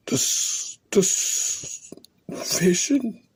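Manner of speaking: usual speaking voice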